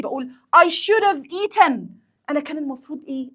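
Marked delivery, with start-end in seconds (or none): 0.6-1.8 high, loud, drawn-out self-blame; self-reproach. Acted. Hungry worker criticising herself in an example, self-reproach. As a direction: scold yourself slowly and emphatically. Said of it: "بنتقد نفسي".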